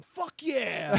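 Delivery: excited cheer. Retold or imitated. Imitating excited fans at a show.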